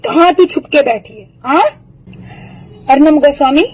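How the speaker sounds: ordinary delivery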